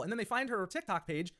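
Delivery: ordinary talk, nothing marked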